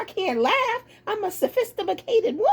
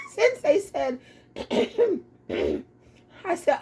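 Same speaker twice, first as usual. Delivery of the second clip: ordinary talk, nothing marked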